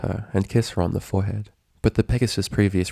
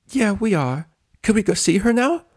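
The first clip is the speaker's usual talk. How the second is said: much higher, bubbly voice; bouncy friend begging to go in; eager Pinkie; 13 semitones higher than usual. A narrator's reading. Pinkie asking the doctor to see Dash, high and eager.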